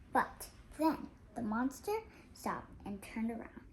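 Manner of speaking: usual speaking voice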